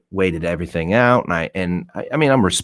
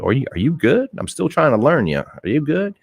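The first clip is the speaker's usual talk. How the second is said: gentle concerned asking; 8 semitones higher than usual. Retold or imitated. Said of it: "It's like".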